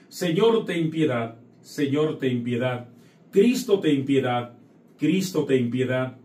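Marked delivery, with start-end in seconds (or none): none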